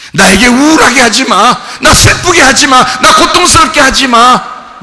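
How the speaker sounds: loud, high demands; firm shouted command; shouted refusal. Retold or imitated. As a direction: order the pain to stop. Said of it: "이게 아포티나스".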